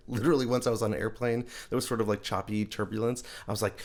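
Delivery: ordinary talk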